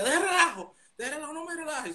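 very high, half-laughing mother; mother's disbelief. Retold or imitated. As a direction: mimic mom who can't believe it.

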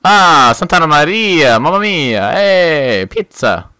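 loud, slow Italian caricature; jolly italian pizza chef. Pretend. Columbus joke, Italian stereotype.

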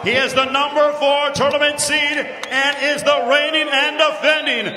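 bilingual, drawn-out champion introduction; milk the champion's name slowly; lucha libre announcer. Acted. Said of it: "lucha libre announcer".

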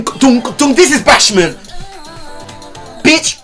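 usual speaking voice

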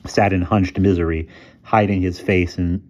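plain reading voice

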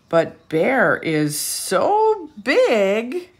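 slow rising read, climbing high; slow and loud, rising to a high peak; building storybook suspense. A narrator's reading.

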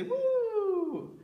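high, loud whoop as the scarf flies; gleeful whoop; excited woo. Shown as a demonstration. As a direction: cheer it up high like a tossed scarf. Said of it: "and we'll say".

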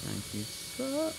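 high, slow falsetto thanks; high mock politeness. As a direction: squeak it out sweetly. Playful reply to pilot.

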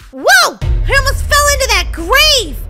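high, loud shock; startled shout. Pretend. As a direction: exclaim with hammed-up fright. Nearly falls into grave.

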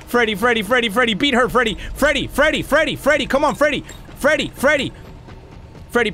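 high, rapid-fire repeated name; frantic calling. Real, not acted. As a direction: plead for help, repeating the name urgently. Frantically calls Freddy for help.

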